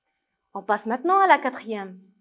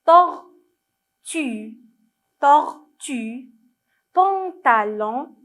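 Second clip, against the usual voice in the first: "Tortue" and "pantalon" broken into slow, separate syllables; syllable-by-syllable reading; 5 dB louder, much slower than usual. Shown as a demonstration. Teacher leading children to read with her, "Tortue" slowly syllabified. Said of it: "Lisez avec moi".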